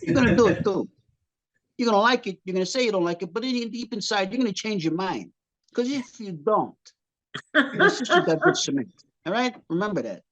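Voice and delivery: a hint of a Sicilian mob boss; mock Sicilian threat. Wife warning husband Sicilian style, lightly voiced mob threat. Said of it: "Here's where the Sicilian comes in".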